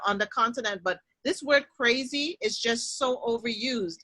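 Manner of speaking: normal talking voice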